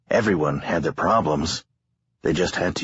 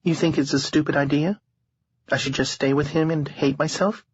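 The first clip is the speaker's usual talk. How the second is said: lightly voiced young woman, higher; a hint of a tired young woman; 11 semitones higher than usual. A narrator's reading. Harlow voiced in narration.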